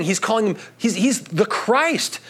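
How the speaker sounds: ordinary talk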